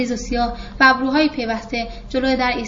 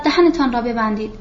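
usual speaking voice

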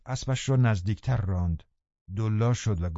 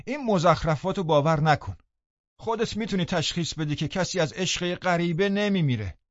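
raised, higher-pitched indignant protest; calling out loudly; 6 semitones higher than usual. A narrator's reading. Servant shouts from behind the door at the liar, loud and indignant. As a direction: call out indignantly through a door. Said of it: "با صدای بلند گفتم".

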